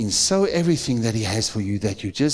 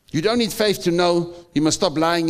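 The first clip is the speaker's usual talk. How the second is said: ordinary talk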